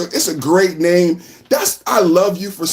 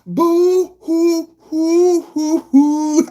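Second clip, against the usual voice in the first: high fake sobbing; hammed-up boo hoo; mock boo-hoo crying; 8 semitones higher, much slower than usual.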